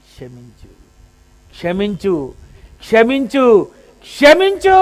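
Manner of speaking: rising plea, hushed to full voice; whisper building to a cry. Acted. Blood's repeated plea.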